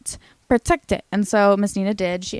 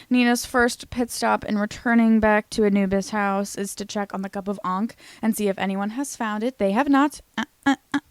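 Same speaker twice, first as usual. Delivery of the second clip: ordinary delivery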